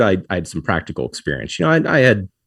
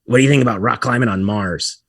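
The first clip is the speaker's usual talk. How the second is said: higher, nasally phone questioner; put-on nasal voice, pitched up; 4 semitones higher than usual. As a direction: imitate a nasal stranger cutting in. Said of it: "This nasal voice goes".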